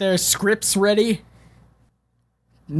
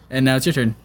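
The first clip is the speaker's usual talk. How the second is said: ordinary delivery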